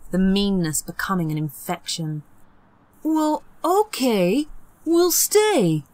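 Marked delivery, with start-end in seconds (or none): 3.0-5.8 much higher, slow sugary agreement; fake sweet. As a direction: hide a mean grin behind sweetness. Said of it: "Peter smiled".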